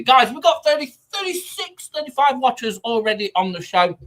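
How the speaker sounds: talking with a mouthful; words round a bite of food; mouth full. Real, not acted. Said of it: "I'm talking with my mouth full".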